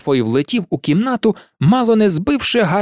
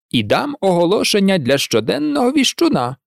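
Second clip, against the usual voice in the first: вищим тоном, з натяком; додай це багатозначно; 4 semitones higher than usual. A narrator's reading. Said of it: "додав він багатозначно". (багатозначно, з підвищенням тону)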